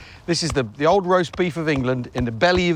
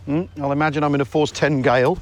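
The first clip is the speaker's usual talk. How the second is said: normal talking voice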